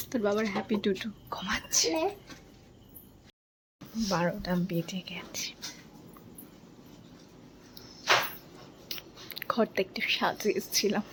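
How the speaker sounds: soft whisper beside a sleeper. Real, not acted. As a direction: hush it down, he's asleep. Sleeping husband, surprise party.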